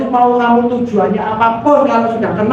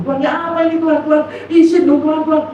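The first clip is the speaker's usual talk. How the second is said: higher-pitched, retold plea to God; mock pleading; 6 semitones higher, faster than usual. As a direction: beg in bewilderment, lightly acted. Retold pleading with God while crying. (memohon bingung dengan nada tinggi)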